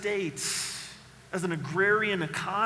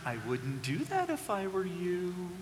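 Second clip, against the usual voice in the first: a hint of a knowing parent; wise parent warning; 8 dB quieter than usual. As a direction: caution a child gently. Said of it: "Our parents said to us very wisely".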